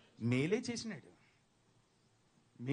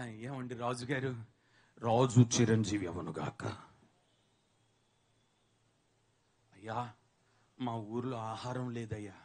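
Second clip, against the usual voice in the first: lightly voiced pleading brothers; 11 semitones lower than usual.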